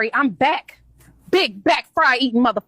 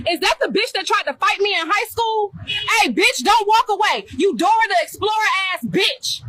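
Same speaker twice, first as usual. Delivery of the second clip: shouting; 8 semitones higher than usual. Acted. Vlogger yelling at old rival.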